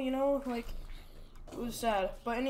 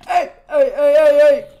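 alarmed yelling; 3 semitones higher, 17 dB louder than usual. Real, not acted. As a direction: yell to stop it at once. Stopping a mob attack.

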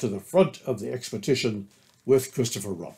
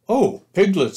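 high, louder excited greeting; excited Pooh; 8 semitones higher, 6 dB louder than usual. A narrator's reading. Pooh runs up to Piglet with news, excited. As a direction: burst in eagerly, pitch lifted. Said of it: "said Pooh excitedly".

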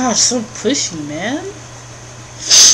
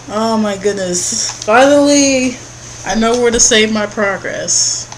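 relieved exclamation; 3 semitones higher, 7 dB louder than usual. Real, not acted. Relief at save point.

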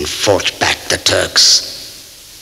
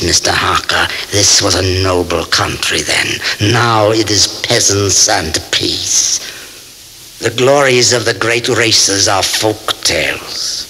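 ordinary talk

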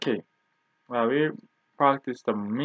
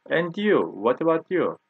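ordinary talk, nothing marked

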